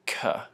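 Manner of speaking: a clean hard "K" from the back of the hard palate; hard K sound. Shown as a demonstration. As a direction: demo of a hard "K". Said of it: "if you make a K sound with your mouth".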